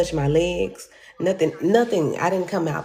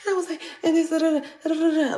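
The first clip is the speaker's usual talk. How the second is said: playful crash noises; 12 semitones higher, faster than usual. Retold or imitated. Mimicking crash with sound.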